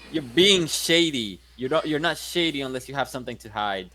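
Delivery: normal talking voice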